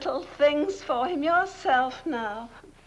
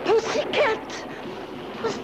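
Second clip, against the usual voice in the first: higher, raised call to the cat; 7 semitones higher than usual. Acted. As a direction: sister scolding the cat off Arnie, calling out. Calling the cat off the coffin.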